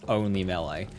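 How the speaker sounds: ordinary delivery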